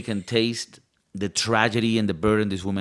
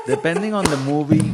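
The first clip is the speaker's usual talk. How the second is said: laughing; 4 semitones higher, 3 dB louder than usual. Real, not acted. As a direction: repeat it while laughing. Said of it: "Did I say that like that?".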